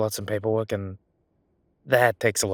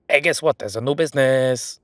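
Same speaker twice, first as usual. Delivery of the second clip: high, loud, hammed-up announcement; loud sing-out of a mock bulletin; 4 semitones higher, 9 dB louder than usual. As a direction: proclaim it like big news. Acted quote of cheery notification.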